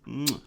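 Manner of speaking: a smacking air kiss; blown kiss. Real, not acted. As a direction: blow the listeners a kiss. Blows kiss to listeners.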